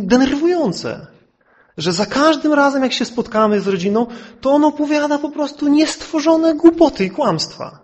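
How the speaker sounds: high-pitched, emphatic retold rant; irritated neighbour. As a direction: imitate an annoyed neighbour ranting about a relative. (wysoki, wzburzony głos zirytowanego sąsiada)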